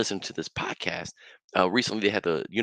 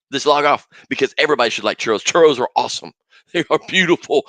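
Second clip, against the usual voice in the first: churro rant; 5 semitones higher, 10 dB louder, faster than usual. Real, not acted. Ranting that everyone must love churros.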